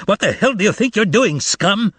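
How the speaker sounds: high angry bark; barked insult. Acted. Goatee clerk barking at the thieves, high and angry. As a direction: snap at the thieves. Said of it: "barked out".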